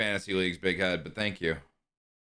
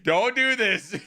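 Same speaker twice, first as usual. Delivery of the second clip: hammed-up dramatic plea; 10 semitones higher, slower than usual. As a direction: overact the plea like a soap star.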